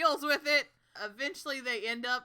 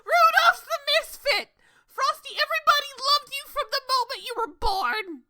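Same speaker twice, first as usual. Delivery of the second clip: high, loud exasperated cry; over-the-top pleading yell; mock protest; 9 dB louder than usual. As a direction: shout it at Frosty in hammed-up outrage. Acted protest to Frosty, amid laughter.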